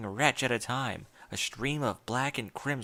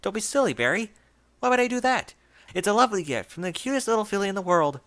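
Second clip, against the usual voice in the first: much higher and louder, gushing and fast; forced cheerfulness; 7 semitones higher, 6 dB louder, faster than usual. A narrator's reading. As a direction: gush brightly to hide terror. Overbright fake praise.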